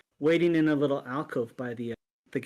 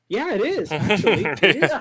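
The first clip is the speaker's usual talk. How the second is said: cocky retort; 4 semitones higher, 8 dB louder than usual. Retold or imitated. Retold cocky reply to attacker.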